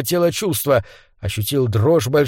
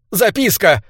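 high, tight angry exclamation; said through clenched teeth; 7 semitones higher than usual. A narrator's reading. Sir Andrew remembers the clerk's note, seething. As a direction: force the word out through the teeth. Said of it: "процедил он".

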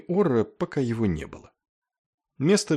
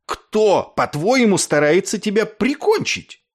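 high, loud indignant questioning; heated, high-pitched challenge; angry demand; 10 semitones higher, 5 dB louder than usual. A narrator's reading. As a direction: demand an answer at a raised pitch. Clevinger keeps shouting in the quarrel.

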